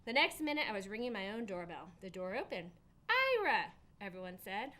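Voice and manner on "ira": high, loud surprised cry; surprised call. Acted. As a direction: exclaim his name in surprise. Family surprised at door.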